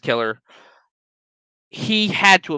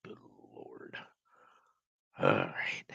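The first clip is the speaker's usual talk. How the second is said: breathy whisper to herself; 16 dB quieter, slower than usual. Real, not acted. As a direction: whisper it to settle down. Whispering to self after rant.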